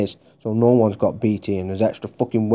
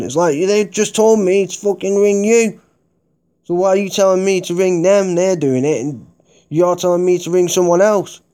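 exasperated outburst; 9 semitones higher, 5 dB louder than usual. Real, not acted. Furious rant about being redirected.

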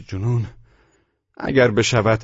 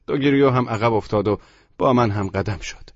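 ordinary delivery

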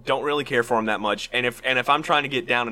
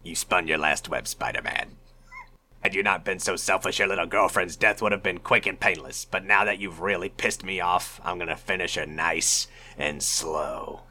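Green Goblin line; 4 semitones lower than usual. Retold or imitated. Reading the Goblin's threat to friends, low and slow. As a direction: gloat low then burst out. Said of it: "read you this line from the Green Goblin".

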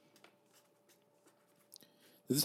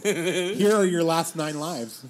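announcer voice; 5 semitones higher, 6 dB louder, slower than usual. Acted. Mock dramatic announcement.

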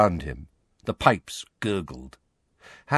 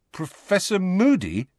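uncertain; 10 semitones higher than usual. Acted. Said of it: "he said uncertainly".

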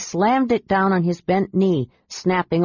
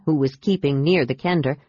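ordinary delivery

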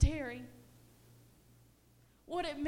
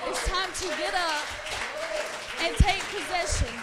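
louder, driving the line home; preaching shout; 3 semitones higher, 7 dB louder than usual. Acted. Preacher urging the church, shouting. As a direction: hammer it at the congregation.

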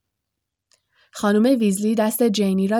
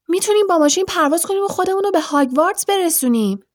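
higher, fast and eager; eager pitch; 8 semitones higher, 3 dB louder, faster than usual. A narrator's reading. Ron excitedly pitches flying the car to Harry. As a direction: rush the plan out in excitement.

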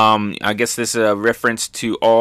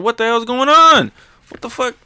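much higher startled yell, then a higher squeak; mock shocked; 12 semitones higher, 5 dB louder than usual. The crowd panicking at the shaking ground, much higher and alarmed. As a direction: everyone freaking out as the ground shakes. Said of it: "Everyone's thinking, like".

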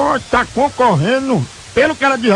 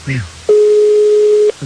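drawn out; 9 semitones higher, 4 dB louder than usual. Acted. Drawn-out announcement.

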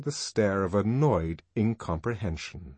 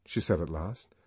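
ordinary delivery

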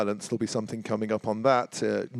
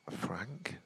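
whispered, unsure guess; 19 dB quieter than usual. Real, not acted. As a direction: breathe the guess out. Guessing uncertainly.

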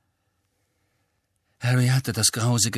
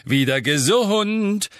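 high, loud, slow joyful announcement; beaming call; 10 semitones higher, 5 dB louder, slower than usual. A narrator's reading. Mr Weasley entering the kitchen, beaming loudly. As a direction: call it out glowing with joy. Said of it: "rief er strahlend".

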